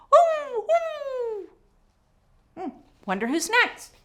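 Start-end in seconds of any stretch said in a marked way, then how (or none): 0.1-1.5 high howling woo; wolf howl. Shown as a demonstration. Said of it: "wolves howling".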